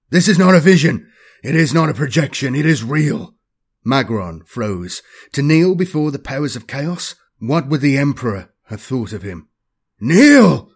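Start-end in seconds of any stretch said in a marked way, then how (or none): 0.1-3.4 raised, insistent captain's voice; barked. A narrator's reading. Captain insisting to his sergeant that it is real, barked. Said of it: "he barked". 10.0-10.7 very loud, high shouted command; harsher bark. A narrator's reading. Captain repeating his order to the sergeant, harshly shouted. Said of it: "Abadas's voice became harsher".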